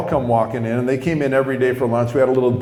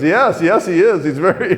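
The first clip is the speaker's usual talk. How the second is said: higher, louder awkward agreement; flustered; 6 semitones higher, 6 dB louder than usual. Retold or imitated. Teacher scooting away from crying man, flustered. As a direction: imitate an uncomfortable man humoring someone. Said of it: "he doesn't know what to do".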